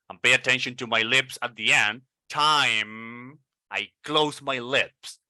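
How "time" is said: normal talking voice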